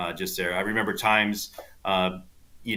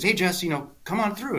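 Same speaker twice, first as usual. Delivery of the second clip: higher-pitched retold invitation; a hint of the pro calling; imitated golf pro; 8 semitones higher than usual. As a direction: lightly voice a pro waving someone over. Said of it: "he calls me, he goes".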